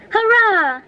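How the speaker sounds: high joyful cheer; cheering shout. A narrator's reading. Boy cheering as the cow gallops home, high shout. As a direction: throw a happy hurrah skyward. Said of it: "waved his cap ... and shouted".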